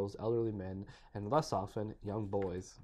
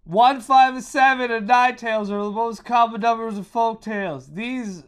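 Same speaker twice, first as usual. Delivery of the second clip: higher, much louder yell; 12 semitones higher, 15 dB louder, slower than usual. Shown as a demonstration. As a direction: yell it across the room. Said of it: "You want me to yell it".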